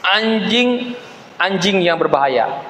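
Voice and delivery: "anjing-anjing" drawn out very slowly, with a pause; penuh penekanan. Shown as a demonstration. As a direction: stretch out "anjing-anjing" deliberately, word by word. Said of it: "pakai nada gitu kan". ("anjing-anjing" diucapkan lambat dengan nada ditekankan)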